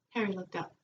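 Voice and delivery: ordinary delivery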